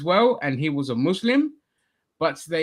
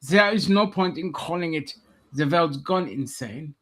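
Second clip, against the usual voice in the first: put-on German accent; host the show as a mock German; 2 semitones higher than usual. Presenter opens the show, mock German accent. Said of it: "speaking in a German accent".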